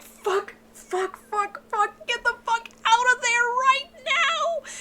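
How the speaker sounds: high-pitched yelling, swearing in a panic, slow and loud on the last line; screaming at the page. Retold or imitated. Reader yelling at a character to get out of there, panicked scream. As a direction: scream a warning at the characters in the book. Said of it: "And I'm screaming".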